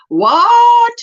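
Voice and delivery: high, loud what; surprised what. Real, not acted. Hearing of a wind alert back home, a loud high what. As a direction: shoot up in disbelief.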